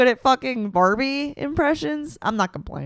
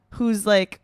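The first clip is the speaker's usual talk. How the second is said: ordinary delivery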